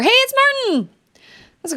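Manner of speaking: high, delighted welcome; bright-eyed, high-pitched hello; excited greeting. Real, not acted. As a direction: greet an arriving friend in a high voice. Greeting a viewer who just arrived.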